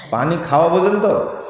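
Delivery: usual speaking voice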